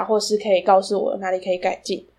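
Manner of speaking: ordinary delivery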